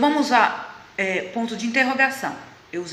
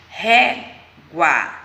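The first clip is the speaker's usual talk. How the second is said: "régua" split in two, "ré" punched louder; stress on RÉ; 2 semitones lower, 6 dB louder than usual. Shown as a demonstration. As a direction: say "régua" by syllables, strong on "ré". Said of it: "É o ré". ("régua" com o "ré" bem forte)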